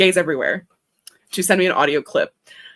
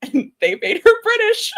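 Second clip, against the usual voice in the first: laughing; 4 dB louder, slower than usual. Real, not acted.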